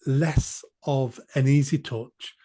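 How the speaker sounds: ordinary talk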